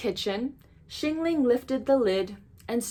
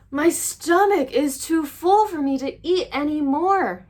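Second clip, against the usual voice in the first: higher, louder overfull groan; groaning monster; 7 semitones higher, 6 dB louder than usual. Acted. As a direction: moan it like a stuffed beast. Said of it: "he groaned".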